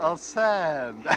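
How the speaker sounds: slow, contemptuous repeat of a sandbag; scornful repeat. Acted. Stranger on the train mocking the sandbag idea, slow scorn. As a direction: sneer at the silly idea.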